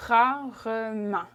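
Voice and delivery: rarement slowly in three full syllables; correct practice form. Shown as a demonstration. Teacher urges correct practice, slow fully sounded rarement. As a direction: stretch rarement out as ra, re, ment. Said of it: "practice correctly".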